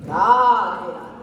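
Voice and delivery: drawn-out holy chant; slow blessing. Acted. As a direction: bless them slowly, piously.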